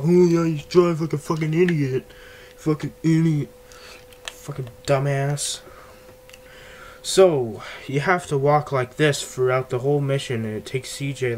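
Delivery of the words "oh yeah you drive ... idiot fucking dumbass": mocking Ryder. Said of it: "when you're complaining about".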